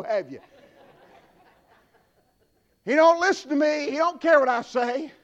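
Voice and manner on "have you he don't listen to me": usual speaking voice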